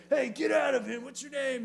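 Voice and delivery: high, loud command to the demon; commanding shout. Retold or imitated. Jesus ordering the demon out of the man, loud and high. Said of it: "and then he said".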